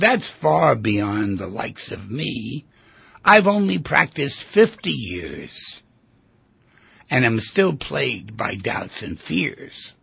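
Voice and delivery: slow, measured poem recital; poem reading. Acted. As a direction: savour each line of the poem slowly. Reads a psychiatrist's poem.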